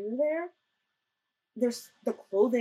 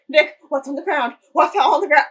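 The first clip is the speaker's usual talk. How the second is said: loud, urgent mock panic; retold frightened questioning; terrified, imitated; 4 semitones higher, 9 dB louder than usual. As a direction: act out his alarm, getting louder on the repeat. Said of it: "you can hear in his voice he was terrified".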